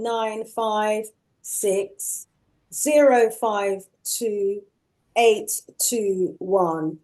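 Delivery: slow, spaced-out digits; dictating. Real, not acted. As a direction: read each number back slowly. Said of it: "can you read it back to me".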